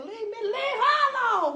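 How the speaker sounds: high, loud laughing shout. Retold or imitated. Speaker in psych ward, shouting high and loud. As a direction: yell at them to leave her alone. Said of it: "I said".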